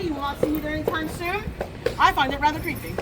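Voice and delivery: usual speaking voice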